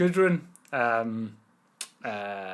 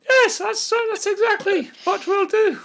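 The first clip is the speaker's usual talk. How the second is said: very high, loud crone falsetto; old woman voice; 11 dB louder than usual. Acted. Gudrun agreeing with the plan, in a high old crone voice. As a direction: crank the old lady squeak right up. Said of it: "a blend of Old Woman three".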